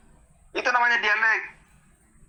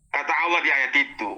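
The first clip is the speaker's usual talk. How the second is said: normal talking voice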